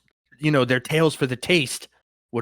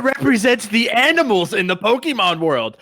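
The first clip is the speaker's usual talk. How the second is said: higher and louder, pressing the question; raised voice cornering his friend; loud challenge; 7 semitones higher, 4 dB louder than usual. Real, not acted. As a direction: hammer the question home. Pressing a point in debate.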